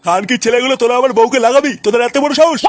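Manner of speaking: outraged husband confronting the lads. Acted. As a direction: build to an indignant shout. Husband furious at youths' proposition.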